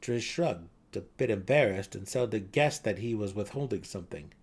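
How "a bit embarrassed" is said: ordinary delivery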